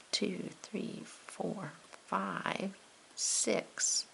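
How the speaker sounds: very soft, private counting; whispered counting. Real, not acted. Teacher counting lines to herself, whispered. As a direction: whisper the count under your breath.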